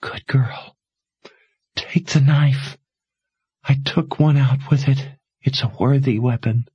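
lightly voiced dying mother, urgent and tender; a hint of a dying mother pleading; faintly voiced mother urging her to run.

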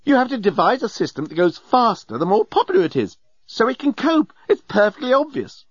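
very high, exasperated rant; exploding. A narrator's reading. Journalist arguing with Nettie about transport systems, exploding. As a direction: lecture indignantly at a high pitch. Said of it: "exploded the journalist".

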